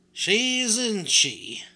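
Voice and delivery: loud, high eager agreement; jolly shopkeeper. A narrator's reading. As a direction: agree warmly and loudly. Doll mender agrees with customer.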